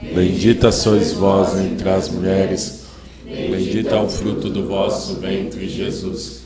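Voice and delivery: slow, measured Hail Mary recitation; communal prayer recitation. Real, not acted. Leading the congregation in the Hail Mary, slow prayer recitation.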